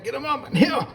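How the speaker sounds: very high, hollered hunting call; rabbit-dog call. Retold or imitated. Hunter calling rabbit dogs back in the day, high holler. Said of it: "calling rabbit dogs back in the day".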